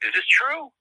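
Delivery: caller, stunned, asking if it's true; incredulous question. Retold or imitated. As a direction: imitate a stranger who can't believe it. Southern caller asks incredulously.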